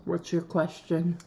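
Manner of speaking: ordinary delivery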